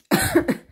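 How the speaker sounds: sharp dry cough; single harsh cough. Real, not acted. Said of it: "je tousse encore".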